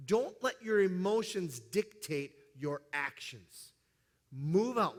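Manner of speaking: ordinary delivery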